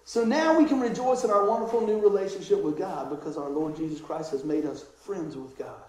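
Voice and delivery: ordinary talk, nothing marked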